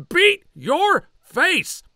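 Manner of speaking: very high, slow, drawn-out order; high drawn-out drill sergeant yell; drill sergeant shriek. Acted. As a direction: stretch each word, screaming.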